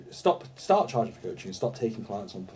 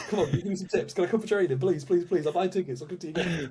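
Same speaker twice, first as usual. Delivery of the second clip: pleading fanboy; 7 semitones higher than usual. Pretend.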